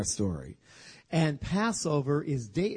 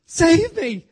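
much higher, loud cry for help; desperate shout; 13 semitones higher, 11 dB louder than usual. Acted. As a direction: yell it as you go under. Peter sinking cries out.

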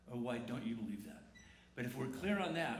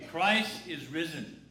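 slow, louder call to the room; a slow call-and-response shout; loud proclamation; 2 semitones higher, 7 dB louder, much slower than usual. Shown as a demonstration. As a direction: proclaim it and wait for the answer. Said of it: "There you go".

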